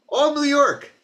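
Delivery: put-on high-pitched old New York accent; high, emphatic New York accent; father's old New York accent. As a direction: say it like an old-time New Yorker. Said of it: "That's how my dad used to talk".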